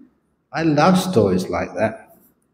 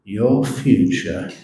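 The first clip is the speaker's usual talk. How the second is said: slow, soft and deliberate; a grave monk's slow, quiet verdict; very slowly; 5 dB quieter, slower than usual. Retold or imitated. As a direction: let each word land calmly, with a pause. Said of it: "very slowly ... said".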